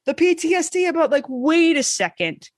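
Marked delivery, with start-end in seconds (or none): none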